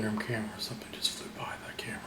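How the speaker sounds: fast startled whisper; hurried whisper to the camera. Real, not acted.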